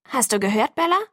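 mildly indignant friend's question. A narrator's reading. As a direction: check sharply if she's listening, a touch annoyed. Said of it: "fragte Jess ungehalten". (leicht ungehalten)

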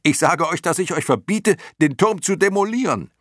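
very high, loud yell upward; half-annoyed shout. A narrator's reading. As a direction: yell a ban up at them, exasperated. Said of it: "schrie Bastian halb ärgerlich". (halb ärgerlich laut hinaufschreiend)

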